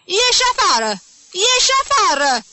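very high, loud repeated screams; frantic furious yelling; enraged bellow. A narrator's reading. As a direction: scream the order, completely losing control. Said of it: "zbieră el scos din fire".

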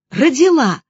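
high, loud cry of surprise; joyful gasp. A narrator's reading. Heroine hearing the birth news, surprised cry. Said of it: "ахнула я".

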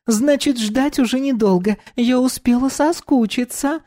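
high languid purr; femme fatale. Acted. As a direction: purr it out like a seductress. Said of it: "Томно промурлыкала она".

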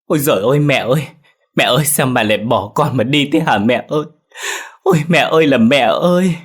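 loud, high, fast grief-stricken wail; wailing for mother. Acted. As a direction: howl out his grief for his dead mother. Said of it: "Ông rú lên rồi chạy thẳng về nhà".

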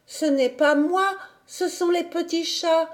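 put-on high, small child's voice; protesting little girl. A narrator's reading. Heidi finally explains about the kittens, high childlike protest.

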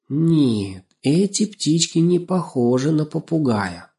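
slow, musing; thoughtful. A narrator's reading. Little man doubting they are parrots, thoughtfully. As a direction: disagree while still thinking it over. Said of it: "задумчиво сказал Лёшиков".

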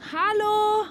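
bright high call across the distance. Acted. Deirdre calls to her godchild across the settlement, loud call. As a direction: shout a greeting from afar.

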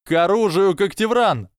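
high rallying cry; shouted out. A narrator's reading. Michael Corner calling Ravenclaw to arms, shouted. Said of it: "выкрикнул Майкл Корнер".

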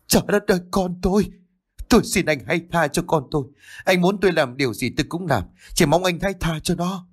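put-on a mother howling and begging for her child; wailing plea. A narrator's reading. The mother begs the attacker to spare her son, wailing. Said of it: "gào khóc thảm thiết".

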